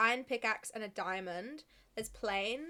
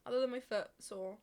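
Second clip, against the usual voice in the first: ordinary delivery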